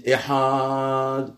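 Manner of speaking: "ehad" with a long held A before the D; drawn-out syllable. Retold or imitated. Teacher imitating people who lengthen syllables, "ehad" dragged out. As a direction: stretch it out: "ehaaad". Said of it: "les gens rallongent les".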